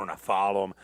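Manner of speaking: normal talking voice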